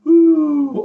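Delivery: high loud falling ooh; a swooping oh no as the glasses drop; mock dismayed ooh. Grandad voicing the glasses' fall with a high ooh.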